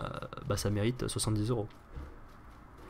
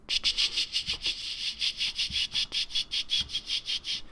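whispered cicada clicks; slower than usual. Pretend. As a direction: click like a cicada under your breath. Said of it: "on va faire les cigales".

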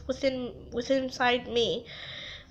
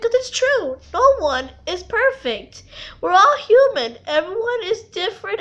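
higher, louder heartfelt plea; impassioned, raised-voice conviction; emphatic insistence; 6 semitones higher, 6 dB louder than usual. Real, not acted. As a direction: insist on it with rising pitch and force. Tearfully insisting nobody is perfect.

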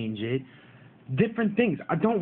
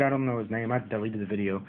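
ordinary talk

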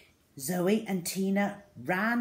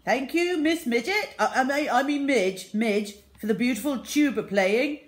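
higher, louder fake-polite headmaster; lying headmaster; 7 semitones higher, 8 dB louder than usual. A narrator's reading. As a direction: gush a lie through a stumble. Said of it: "lied Mister Grave".

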